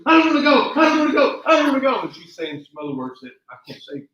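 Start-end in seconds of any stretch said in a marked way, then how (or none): 0.1-2.0 much higher, louder terrified cries; panicked yelling. Retold or imitated. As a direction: scream it, clinging to the rail. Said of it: "She goes".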